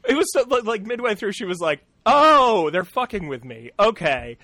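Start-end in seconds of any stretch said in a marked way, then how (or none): 2.1-4.4 big loud "Oh", then settling; retold loud realization; louder retort. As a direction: act out catching on to the hecklers. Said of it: "she just started getting louder".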